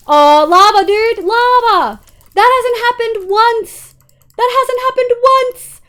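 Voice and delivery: high, loud, slow disbelieving yell; outraged yelling. Real, not acted. Streamer yelling at chat about the lava. Lava set base on fire.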